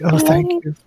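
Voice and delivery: very high, weepy gratitude; tearful thanks. Acted. Crying mother keeps thanking the helper, tearful and high. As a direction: squeak out thanks as if still crying. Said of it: "still kinda crying".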